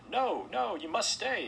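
high, loud urgent plea; exclaiming. A narrator's reading. Host begging departing guests to stay, urgent exclaiming. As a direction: burst out to stop them leaving. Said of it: "exclaimed Mister Harwood".

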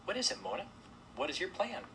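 high, sweet but firm; strong sweet voice. A narrator's reading. Sybil asking Mona for her plan, sweet firm voice. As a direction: cut in firmly yet sweetly. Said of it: "said strongly in her sweet voice".

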